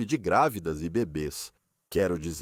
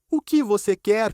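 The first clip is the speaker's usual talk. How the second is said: high voice; 10 semitones higher, 4 dB louder than usual. Retold or imitated. Retelling a courtroom lunch exchange, in a mock high voice. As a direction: quote it in a high, raised voice. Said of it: "Ele fala com voz aguda".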